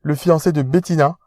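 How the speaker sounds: posh fiancé. Acted. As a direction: speak down to him like an aristocrat.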